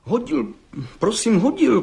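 high-pitched, flustered stammered confession; stammering young bricklayer. Acted. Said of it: "koktá mladý cihlář".

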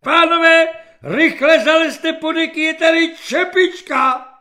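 very high, very loud enraged yell; furious shout. A narrator's reading. Enraged man bursts into the sickroom to warn patients, furious shout. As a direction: burst in and bellow the order at top pitch. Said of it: "silně rozzuřený Lapidův Žužík".

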